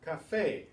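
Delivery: Café stressed on the final FE, high and loud; Spanish ca-FÉ, weight on the end; stress on accented vowel. Shown as a demonstration. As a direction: punch the last syllable of Café. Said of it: "to stress that A".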